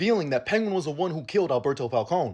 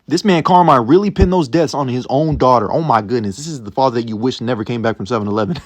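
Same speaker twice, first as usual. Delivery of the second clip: much louder, riled-up disbelief; real outrage; 5 semitones lower, 8 dB louder than usual. Real, not acted. Vlogger ranting to viewers about Carmine, loud and outraged. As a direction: let it burst out at the father's betrayal. Outraged reaction to father's betrayal.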